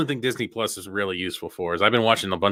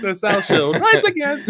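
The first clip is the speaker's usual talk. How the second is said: high, slow mock Indian accent through laughter; laughing, drawn-out Indian accent; Apu; 9 semitones higher, slower than usual. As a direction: repeat the cartoon Indian-accented line, cracking up. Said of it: "Apu comes out with a Confederate".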